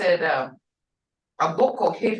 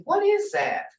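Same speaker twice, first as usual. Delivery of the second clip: high, questioning "What is that?"; curious question voice; asking tone; 7 semitones higher than usual. Shown as a demonstration. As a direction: ask it like you're puzzled. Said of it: "like something you're asking".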